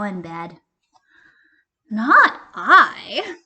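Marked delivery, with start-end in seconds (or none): 1.9-3.4 loud, high "Not I"; goose's loud refusal. Acted. Goose to hen, loud refusal.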